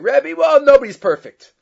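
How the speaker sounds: loud, high, flippant shrug of a reply; cheeky retort. Retold or imitated. Student answers his dying teacher in a loud, flippant way. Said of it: "that how you talk to a Rebbe?".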